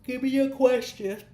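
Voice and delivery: words stretched by a yawn. Real, not acted. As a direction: say it mid-yawn. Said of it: "I'm yawning".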